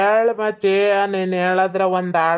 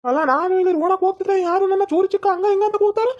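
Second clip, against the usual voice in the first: much higher, fast, offended rant; indignant outburst; 9 semitones higher, faster than usual. Acted. The man who was called Chori Chikka, fast and indignant. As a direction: fire it back at whoever called you that.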